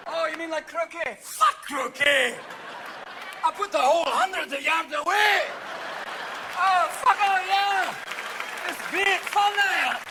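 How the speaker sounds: a Scot shouting his sport idea; mock drunk Scottish bluster; Scottish accent. Scot debating golf.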